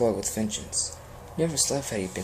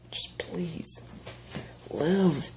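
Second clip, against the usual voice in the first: sniffing; 7 semitones higher, much slower than usual. Acted. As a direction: plead through a sniff. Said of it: "he sniffed".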